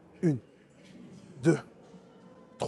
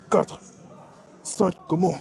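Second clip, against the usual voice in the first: last set of curls, counting through the pain, louder each rep; straining hard; 5 semitones higher, 4 dB louder, much slower than usual. Real, not acted. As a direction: count rising higher and louder to the end.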